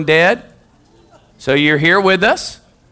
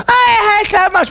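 high, slow whine; high drawn-out child's whine; whiny tired kid. Retold or imitated. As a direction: whine like a worn-out little boy. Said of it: "tired kid whining to coach".

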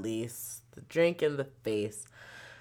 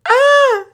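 a loud, high-pitched yelp of a laugh cry; sudden high shout; 17 dB louder than usual. Real, not acted. Shouting out after laughing at her mom's text. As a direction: burst out with a sharp shout.

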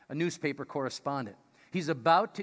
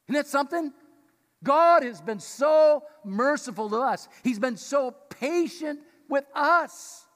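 emphatic; 9 semitones higher, 8 dB louder than usual. Real, not acted. Preacher's emphatic exclamation.

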